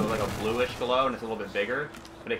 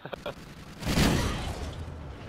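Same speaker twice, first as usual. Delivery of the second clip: laughing; 3 dB quieter than usual. Real, not acted. Laughing at own playstyle.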